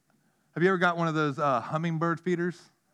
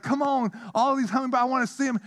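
mock pleading; 9 semitones higher, faster than usual. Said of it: "I'm like".

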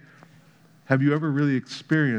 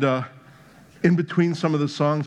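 usual speaking voice

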